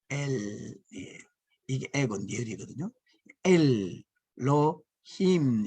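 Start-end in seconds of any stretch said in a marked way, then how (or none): 3.4-5.7 엘, 로, 힘 slowly, with a gap after each part; syllable by syllable. Shown as a demonstration. Teacher breaking the Hebrew word apart to show its plural ending, syllable by syllable. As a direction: stretch and separate 엘 / 로 / 힘. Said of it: "이건 복수고".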